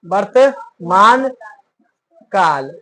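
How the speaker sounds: वर्तमान stretched very slow, काल dropped low and soft; drawn-out answer. Shown as a demonstration. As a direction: spell out वर्तमान slowly then let काल fall. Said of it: "कौन काल?". (धीरे-धीरे खींचकर)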